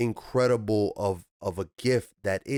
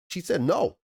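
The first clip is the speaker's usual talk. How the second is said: ordinary delivery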